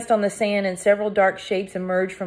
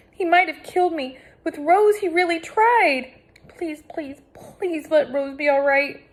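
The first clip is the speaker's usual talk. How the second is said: distraught; 10 semitones higher than usual. A narrator's reading. Distraught girl over injured friend.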